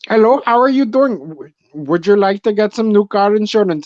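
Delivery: high-pitched Indian telemarketer caricature; hammed-up Indian phone-scam accent; mock Indian accent.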